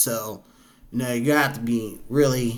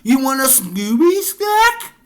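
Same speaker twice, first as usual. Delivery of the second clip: very high, delighted puppy talk; slower than usual. Real, not acted. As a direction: coo at the dog, high and sweet. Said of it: "talk to our pets in our baby voice".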